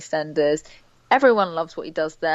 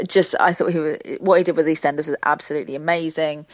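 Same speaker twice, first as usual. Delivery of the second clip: ordinary delivery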